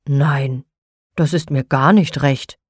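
a hint of a sulky old man saying no; grumpy. Nikolaus turning down Frau Holle, mildly grumpy. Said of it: "sagte der Nikolaus mürrisch".

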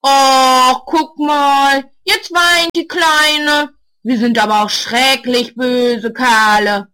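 mock her tears, loud and drawn out; teen mocks a crying girl with a loud, slow sneer; mocking jeer. A narrator's reading. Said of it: "macht sich über sie lustig".

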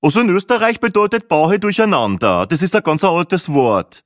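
high, loud know-it-all joke; cheeky wisecracker stretching the punchline. A narrator's reading. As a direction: tease with a grin, slowing at the end. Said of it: "ergänzte Speckhut naseweiß grinsend".

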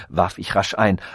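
ordinary delivery

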